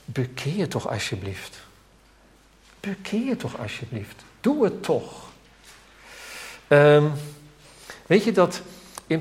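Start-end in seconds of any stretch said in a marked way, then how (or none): 0.0-1.6 soft plea. Shown as a demonstration. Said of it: "zou je bijna kunnen vertalen met". 2.8-5.2 softer, higher begging plea; a quiet, higher plea to come home; tender pleading. Shown as a demonstration. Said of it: "zou je bijna kunnen vertalen met".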